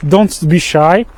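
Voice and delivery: normal talking voice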